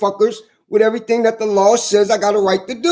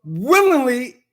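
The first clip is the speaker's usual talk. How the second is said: each word laid down slowly; measured, pointed charge; slow, deliberate accusation; much slower than usual. Real, not acted. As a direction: spell out willingly, knowingly slowly. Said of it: "I have the right to say".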